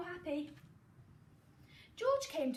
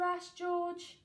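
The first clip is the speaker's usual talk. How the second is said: plain reading voice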